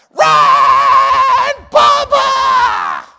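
full-throated, drawn-out high holler; hollering mom. Retold or imitated. Mom in the bleachers to her son at bat, hollering. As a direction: holler it long and loud like a cheering mother. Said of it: "she started hollering".

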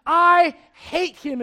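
drawn-out, high, very loud outburst; hateful shout. Acted. King Ahab speaking about the prophet Micaiah, hateful shout. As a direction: stretch each word into an angry shout. Preacher acts out king Ahab's hatred.

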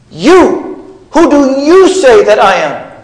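"you" high and punched, set apart by a pause; emphatic "you". Shown as a demonstration. Preacher demonstrating the emphasis in Jesus' question, "you" pitched high and hard. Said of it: "for purposes of emphasis".

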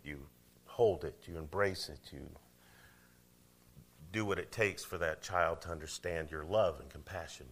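normal talking voice

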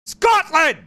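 shouted high and loud, playing a brute; mock Scottish yell. Shown as a demonstration. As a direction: bellow it like a brawling Scot. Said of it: "you gotta yell and kinda be mean".

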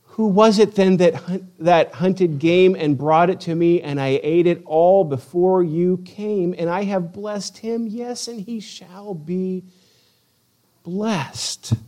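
slow dramatic reading that sinks to a hush; shaken father in scripture. A narrator's reading. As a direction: read it as dawning horror, dying away.

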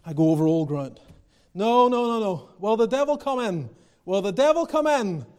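ordinary delivery

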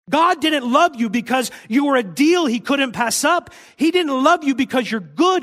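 high and loud, pressing each point home; fervent preaching. Acted. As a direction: preach it at full force to the congregation. Preacher building to full force.

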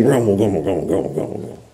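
very low, fading mumble; low muttered grumble dying out; grumbling. Shown as a demonstration. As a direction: grumble under your breath, trailing away. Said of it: "I'm gonna do my best to".

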